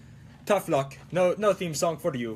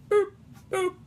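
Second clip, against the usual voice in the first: two short high-pitched boops; cartoonish high "boop, boop"; high boop noises; 12 semitones higher than usual. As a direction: squeak out little button-press boops. Imitates pressing a button unnaturally.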